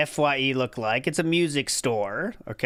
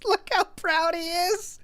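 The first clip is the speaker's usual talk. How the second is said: mock proud; 14 semitones higher, slower than usual. Repeats, higher and slower.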